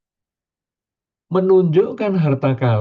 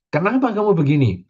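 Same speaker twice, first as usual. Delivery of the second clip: quick, higher accusing why; demanding question; 5 semitones higher, faster than usual. Shown as a demonstration. Preacher contrasting an accusing question with acceptance. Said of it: "pertanyaan diubah menjadi pernyataan".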